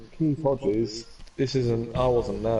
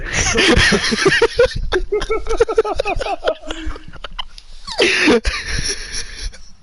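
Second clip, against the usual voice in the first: loud laugh; 8 dB louder than usual. Real, not acted. Laughing at friend's outburst.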